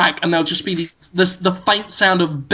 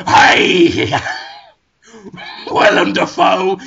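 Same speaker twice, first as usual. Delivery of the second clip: loud villain greeting with a laugh; gruff shout; 3 dB louder, slower than usual. Acted. Villain farmer greeting Willem Dafoe, gruff shout. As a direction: call out like a swaggering villain. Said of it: "waddles in and goes".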